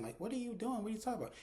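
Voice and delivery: high-pitched, exasperated questioning. Retold or imitated. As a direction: imitate someone fed up and baffled. Woman exasperated with caller.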